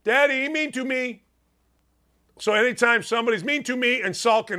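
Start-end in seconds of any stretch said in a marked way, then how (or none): 0.0-1.2 put-on higher, pouting child's whine; whiny little kid. Imitating his daughter complaining to daddy, whiny. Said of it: "that's the voice I go".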